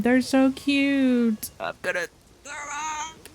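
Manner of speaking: normal talking voice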